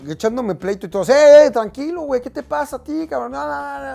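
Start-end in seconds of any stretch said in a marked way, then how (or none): 1.0-3.9 much higher, much louder heated shouting; shouting back. Retold or imitated. Arguing back at the soldier in a shouting match, loud. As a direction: shout him down.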